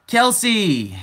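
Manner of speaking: high, loud greeting, falling at the end; calling out. Real, not acted. Streamer greets a late viewer, calling out. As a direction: sing out the name to hail a latecomer.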